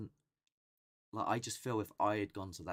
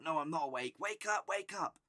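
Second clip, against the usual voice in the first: high, louder, frantic self-urging; mock panic; 11 semitones higher, 5 dB louder than usual. Dreamer realising he's still asleep, high acted panic. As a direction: plead with yourself to wake, pitched right up.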